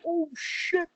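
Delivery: high-pitched oh shit; high shocked. Retold or imitated. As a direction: gasp it out caught. Retold shock.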